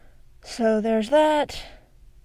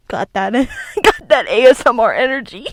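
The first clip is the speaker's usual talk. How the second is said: laughing; 8 dB louder than usual. Real, not acted. Joking about ASMR energy, laughing.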